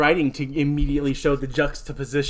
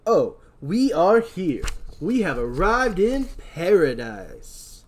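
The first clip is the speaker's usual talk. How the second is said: slow, higher, drawn-out delight; awed new arrival; 4 semitones higher, much slower than usual. Acted. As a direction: savour the arrival, stretching each phrase. Voicing Tyrell arriving at resort.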